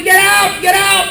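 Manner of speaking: high, shouted command to dogs; mock yell. Caregiver yelling the dogs out, mock yell. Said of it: "Here I am yelling".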